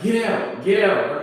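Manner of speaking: loud, high barked order; yelling. Retold or imitated. Cop ordering passengers out of the car, yelling. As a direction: shout it like an armed cop. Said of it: "so he's yelling".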